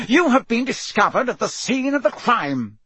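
high-pitched, heated charge; frenzied accusation. A narrator's reading. Crouch accuses the children, frenzied accusation. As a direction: accuse as if slightly mad. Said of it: "He looked slightly mad".

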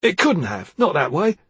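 loud sharp protest; cried out. Acted. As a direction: blurt the denial out. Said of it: "Ruddock cried".

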